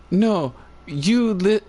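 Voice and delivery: put-on high, slow bossy toddler; little girl voice. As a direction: play a stern tiny girl. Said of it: "she's like".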